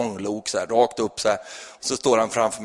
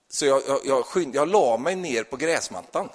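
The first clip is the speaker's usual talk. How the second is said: ordinary talk, nothing marked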